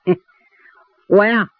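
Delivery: haughty, drawn-out reply with pauses; deliberate drawl. A narrator's reading. Posing steward giving a trick name to gate guard, drawling haughtily. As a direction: stall arrogantly between the words. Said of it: "郭云故意拉着长声".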